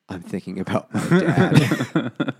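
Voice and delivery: slow, giggling mock husband voice; laughing mock reply. Mocking the husband's thoughts, slow and laughing. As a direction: laugh through it while playing him.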